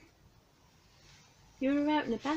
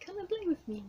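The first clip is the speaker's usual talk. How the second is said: voicing the cat; 10 semitones higher, faster than usual. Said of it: "You were like".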